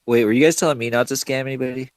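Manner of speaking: ordinary talk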